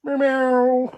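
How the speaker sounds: high, loud mock meowing; loud, high playful meow; cat meows. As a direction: play the cats. Imitating cats on shortcut.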